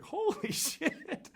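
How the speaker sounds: drawn-out, slow, laughing disbelief; stunned. Retold or imitated. Friends watching the pot smash, slow stunned laugh. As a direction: let it stretch out in shocked amazement. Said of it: "everyone just goes like".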